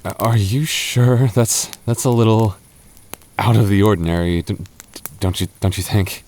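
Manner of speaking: ordinary delivery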